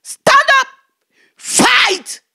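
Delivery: very loud, high heavenly call. Retold or imitated. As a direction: rouse her like a voice from above. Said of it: "Then a voice spoke to me".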